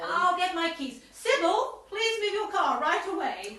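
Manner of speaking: loud, urgent command; urgent order. Acted. Chairwoman loudly orders Sybil to move her car.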